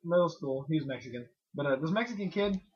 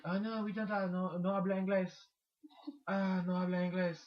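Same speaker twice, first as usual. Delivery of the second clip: put-on Spanish accent; 7 dB quieter than usual. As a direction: feign no English with a Spanish accent.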